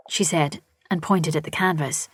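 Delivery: plain reading voice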